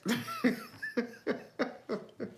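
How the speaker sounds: high, soft giggle; teasing laughter. Real, not acted. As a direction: giggle at your own teasing. Repeats joke about stealing tracks.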